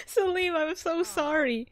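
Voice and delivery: sheepish, giggly apology; laughing apology. Real, not acted. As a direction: apologise while still giggling. Apologising to the neighbour.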